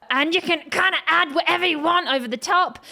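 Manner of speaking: twangy belt. Shown as a demonstration. Demonstrating the twangy belt while talking.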